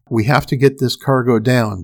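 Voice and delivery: ordinary delivery, nothing marked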